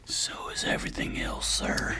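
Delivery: whispered aside; whispering. Real, not acted. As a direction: whisper it slyly.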